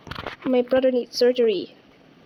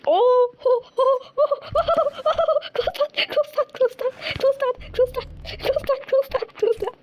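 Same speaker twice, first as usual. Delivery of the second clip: high panicked "oh"s turning to pleading; 9 semitones higher, slower than usual. Real, not acted. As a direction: squeal in alarm and beg over and over. Panicking in a horror game.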